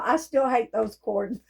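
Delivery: usual speaking voice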